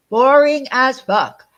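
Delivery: slow, loud, drawn-out insult. Real, not acted. Repeats insult louder and slower.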